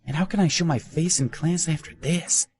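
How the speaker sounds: high, fretful inner voice; embarrassed dismay. A narrator's reading. Ben wondering how to face classmates, high dismay. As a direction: fret over the public humiliation.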